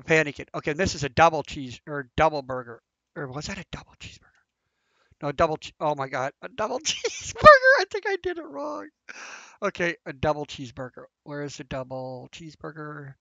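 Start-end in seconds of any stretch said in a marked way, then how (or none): 6.4-8.9 laughing through the order, pitched up; a flustered giggle breaking into the word; laughing mid-word. Real, not acted. Gamer laughing at the order while flustered.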